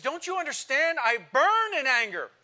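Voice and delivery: burn with it, as Paul crying out; Paul angry. Acted. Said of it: "He says".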